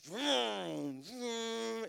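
playful vroom of a jet ski; engine noise imitation. Speaker mimics the lifeguard's jet ski engine, playful. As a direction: mimic the motor coming in.

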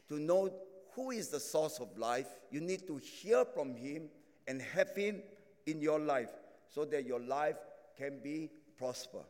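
ordinary talk, nothing marked

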